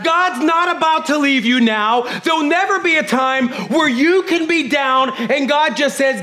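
preaching. Acted. Pastor preaching to his family, full force. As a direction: proclaim it to the congregation.

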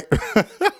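a high amused giggle. Real, not acted. Teacher giggling at tripping over road rage. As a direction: laugh at your own slip. Laughs at slip.

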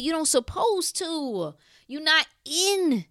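high, loud, pleading cry at the family; emotional outcry. Real, not acted. To mama and grandma who didn't know, a high emotional outcry. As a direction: cry it out to loved ones who didn't understand. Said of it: "I, I get emotional".

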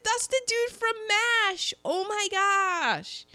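high-pitched fangirl squeal of recognition; gushing, stretched-out high exclamation; excited outburst. Retold or imitated. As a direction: re-enact a thrilled realization, pitch way up. Retelling own excited recognition reaction.

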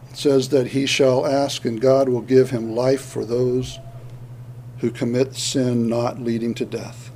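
quiet reflection. Acted. Preacher's quiet reflective turn.

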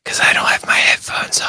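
hushed whisper into the mic. Real, not acted. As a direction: whisper it, barely voiced. Talking without headphones, unvoiced.

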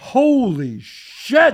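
slow, drawn-out amazed holy shit; stunned disbelief. Retold or imitated. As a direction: stretch it out in awe. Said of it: "And now I'm going".

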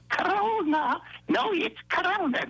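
high, exaggerated Southern accent on "Corona"; put-on Southern accent. Shown as a demonstration. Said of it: "You gotta put the accent".

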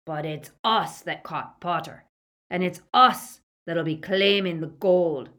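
lightly voiced henchman staking a claim; Greyback. A narrator's reading. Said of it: "interjected Greyback".

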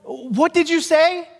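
slow puzzled question. Retold or imitated. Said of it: "I'm like".

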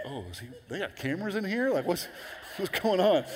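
high, startled mock question; alarmed bewilderment. Viewer caught in the bathroom asking about cameras, startled and high. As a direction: act caught out, pitch climbing as you ask.

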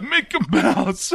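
slow mock-wounded call; hammed-up dubbed dying voice; imitated shot hero. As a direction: drag the name out as if hit by a bullet. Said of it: "he's shot, and he's like".

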